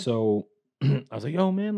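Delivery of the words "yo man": much higher, excited "Yo, man"; bro greeting. Retold or imitated. Greeting the check-in guy at the doctor's, in a pitched-up bro voice. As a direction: hail him like a buddy.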